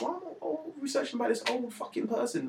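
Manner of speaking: a bored student ranting about research; exasperated. Retold or imitated. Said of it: "And I'm like".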